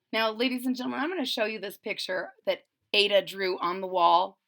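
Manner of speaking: usual speaking voice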